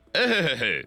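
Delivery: high, rounded ö-sound chuckle; acted laugh on a rounded vowel; storybook laugh. A narrator's reading. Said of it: "Nevetett az ötödik is, így". (magas, öhögő nevetés)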